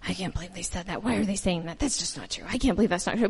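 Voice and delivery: resenting correction from authorities, muttered; muttered complaint. Retold or imitated. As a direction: sulk it quietly to yourself. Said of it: "we're not sitting there going".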